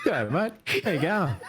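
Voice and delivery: high, bright g'day; mock the overly friendly hello; chirpy matey greeting. Friend imitating the dad greeting strangers, high and cheery. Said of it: "mimicking the g'day greeting".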